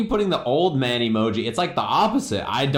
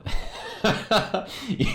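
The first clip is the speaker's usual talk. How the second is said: airy giggle, high and voiceless; a breathless, squeaky little laugh; breathy high laugh; 12 semitones higher than usual. Real, not acted. Streamer laughing at his own sundial joke, airy giggle.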